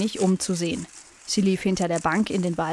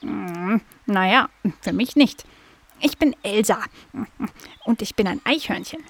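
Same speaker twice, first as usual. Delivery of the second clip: squirrel voice. A narrator's reading. Squirrel introduces herself.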